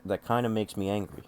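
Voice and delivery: ordinary talk, nothing marked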